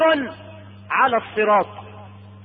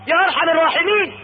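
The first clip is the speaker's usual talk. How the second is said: high, quick cry of supplication; pleading; 7 semitones higher, 3 dB louder, faster than usual. Acted. Man begging God for the tree, pleading. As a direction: call out urgently, begging.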